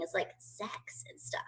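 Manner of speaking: hushed, confiding near-whisper; coy whisper. Real, not acted. Single comedian admitting what she misses in quarantine, coy whisper. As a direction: drop to a whisper for a delicate admission.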